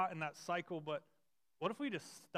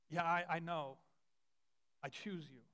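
gentle voice of Jesus. Retold or imitated. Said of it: "Jesus' voice saying".

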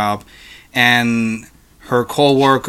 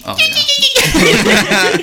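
ordinary talk, nothing marked

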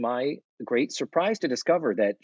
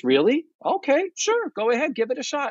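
much higher, sugary fake encouragement; fake support; 14 semitones higher than usual. Natalie faking support to Tootie, high and sugary. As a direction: sound supportive, not mean it. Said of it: "her initial response is".

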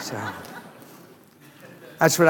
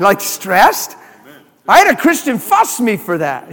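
usual speaking voice

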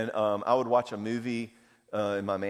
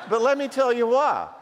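higher and louder, still laughing; 12 semitones higher, 7 dB louder than usual. Real, not acted. Pastor answering the women's objection, laughing and louder. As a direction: push through the laugh.

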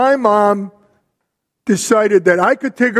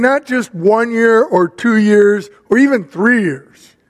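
ordinary talk, nothing marked

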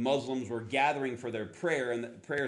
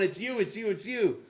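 excited; 6 semitones higher than usual. Retold or imitated. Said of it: "she keeps going".

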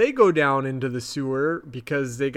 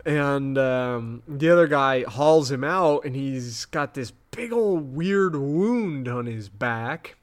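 normal talking voice

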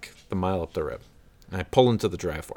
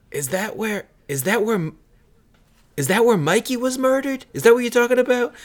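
high, fast, loud imitation of a worked-up bar guy; mock a local bursting with the murder story; excited local; 6 dB louder than usual. Bar patron asks about murder.

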